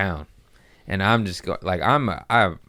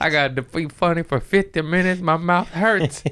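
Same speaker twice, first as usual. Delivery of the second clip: much higher, comic wail of grievance; exasperated complaint; 10 semitones higher than usual. Retold or imitated. Comedian must be funny with sore mouth, exasperated wail. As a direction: play up the hurt and disbelief.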